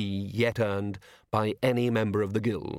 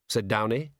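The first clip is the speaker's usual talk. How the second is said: ordinary delivery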